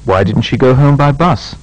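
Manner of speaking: "Why didn't you come last week?" rising steadily, short fall on "week"; a frank, polite question that climbs and dips at the end; "Why didn't you come last week?" with a continuous rise and a final fall; rising tune, short final fall. Shown as a demonstration. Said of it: "Make similar questions using why or how".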